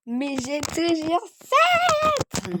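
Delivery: drawn-out victorious cry; slow, loud, proud claim; triumphant. Real, not acted. Relieved after nearly losing lives.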